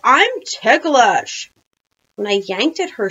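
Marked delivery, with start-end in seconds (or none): 0.0-1.5 high, drawn-out ticklish squeal; giggling. A narrator's reading. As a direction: stretch it out as if being tickled. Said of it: "Missus Rupee giggled".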